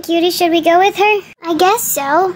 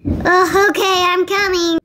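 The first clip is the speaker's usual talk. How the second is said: normal talking voice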